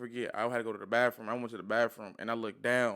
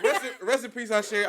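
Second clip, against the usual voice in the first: high, louder, laughing through the words; honoring his late aunt while still laughing, louder; 7 semitones higher, 6 dB louder than usual. Real, not acted. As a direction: giggle out a fond farewell.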